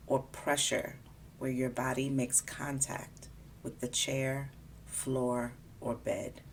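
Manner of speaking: calm, unhurried body scan guidance; guided meditation. Acted. Guiding a listener through a body scan, calm meditation voice. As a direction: ease the listener gently from part to part.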